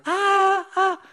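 loud, piercing imitated seabird wail; very high bird cry. Shown as a demonstration. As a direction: cry out shrilly like a wailing baby. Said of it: "Very high".

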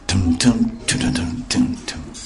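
soft rising tum tum fading to a whisper; whispered drumbeat. Acted. Preacher teasing tomorrow's story, whispered suspense drumbeat. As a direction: tease the next episode with a drum fading out.

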